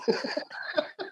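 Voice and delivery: high breathy mocking laugh; teasing laugh. Coach laughing at Bobby over the Texas rumor. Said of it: "he's laughing about it".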